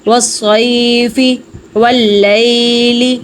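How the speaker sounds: و صیفی, و لیلی with the ی stretched slowly; slow, stretched recitation of صیفی; long vowel. Shown as a demonstration. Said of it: "اب ہم اسے لمبا کریں گے".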